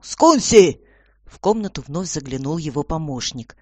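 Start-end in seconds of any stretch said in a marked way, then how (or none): 0.0-0.8 high, loud call for an assistant; loud shout. A narrator's reading. Commissioner calling his assistant, loud shout. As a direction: yell the name to summon him. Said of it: "затем громко крикнул".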